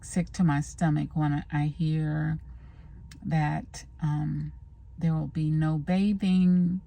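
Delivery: ordinary talk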